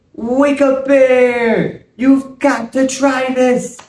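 high loud wake-up shout; calling out to wake someone. Acted. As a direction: belt it out to rouse a sleeper. Repeats wake-up call as spell.

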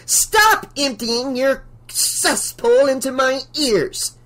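high, slow outraged screech; shrieking. A narrator's reading. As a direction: screech each insult out at the singers. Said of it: "she shrieked".

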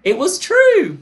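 high, loud triumphant answer reveal; excited reveal. Real, not acted. Quiz host revealing the answer to players, excited. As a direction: announce it like a winning answer.

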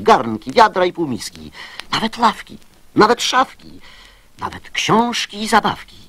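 ordinary talk, nothing marked

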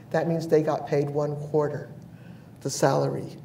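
ordinary talk, nothing marked

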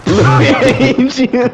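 mock despair. Real, not acted. Teammate mocked in game.

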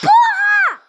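sudden high yell of fright; real jump-scare shout; startled scream. Real, not acted. Gamer screams at a jump scare in a horror game. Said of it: "Susto que eu tomei".

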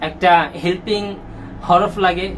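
normal talking voice

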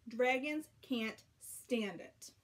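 plain reading voice

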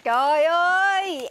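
loud, drawn-out excited announcement; excited shout. Acted. Wife announces her shopping haul, excited loud cry. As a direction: cry it out loudly, stretching the words.

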